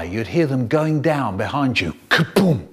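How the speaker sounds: ordinary talk, nothing marked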